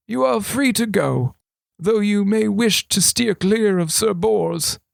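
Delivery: a hint of a sly old regent. A narrator's reading. Regent confiding to thief, lightly voiced old man.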